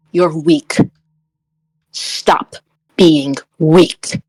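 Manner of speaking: low, deliberate contempt with heavy pauses; stern, halting command; cold intimidation. Acted. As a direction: put each word down firmly and low. Character intimidates her eye to eye.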